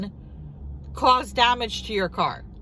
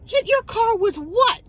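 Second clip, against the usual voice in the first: high, louder disbelief; incredulous outburst; 11 semitones higher, 3 dB louder than usual. Retold or imitated. Answering the bumper accusation, high incredulous. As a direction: fire back in mock outrage.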